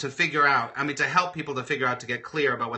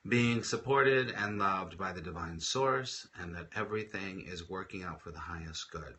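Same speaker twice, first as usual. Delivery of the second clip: leading the group in soft prayer for Diana; quiet prayer; 5 semitones lower, 8 dB quieter than usual. Real, not acted. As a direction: pray gently over a friend in trouble.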